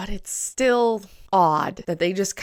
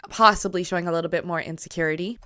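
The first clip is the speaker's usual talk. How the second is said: ordinary delivery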